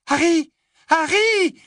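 put-on worried girl yelling his name; calling out loud. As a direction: shout through a locked door. Hermione pounding on the door.

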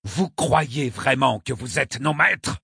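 high, slow indignant challenge. A narrator's reading. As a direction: throw it out in outrage. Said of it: "Mustapha tremblait d'indignation".

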